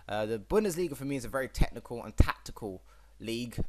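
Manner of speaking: normal talking voice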